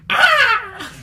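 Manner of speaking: high shrill squawk of a bird; bird cry. Pretend. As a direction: screech like a summoning bird call. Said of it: "summon them with a bird cry".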